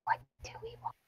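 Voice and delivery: whispered aside to the party; whispering. Real, not acted. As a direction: hush it to your friends. Player asks the table in a whisper.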